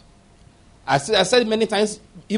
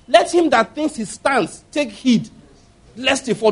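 scripture declaimed; 6 semitones higher, slower than usual. Acted.

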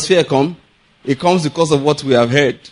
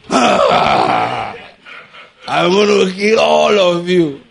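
mock giant; much slower than usual. Goliath taunting Israelites.

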